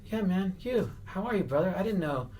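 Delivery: very high comic character voice; Andy Kaufman. Retold or imitated. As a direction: greet them in a put-on high voice. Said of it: "staying in the Andy Kaufman character".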